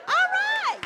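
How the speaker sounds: high, loud all right; excited cheer. Real, not acted. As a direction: whoop it up with joy. Cheer after winner found.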